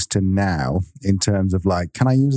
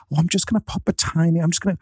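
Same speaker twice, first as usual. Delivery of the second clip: sweet mum voice; 7 semitones higher, faster than usual. Retold or imitated. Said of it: "And then she'll just go".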